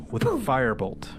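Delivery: ordinary delivery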